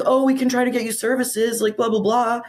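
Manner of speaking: high, sweetly placating caseworker; mock social worker voice. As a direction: imitate a patronizing social worker offer.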